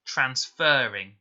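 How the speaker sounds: transferring said slowly, the fer drawn out long; teacher slowly sounding out transferring, fer held long and stressed. Shown as a demonstration. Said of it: "whether it's making a long or a short sound".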